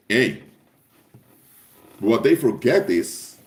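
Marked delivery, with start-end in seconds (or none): none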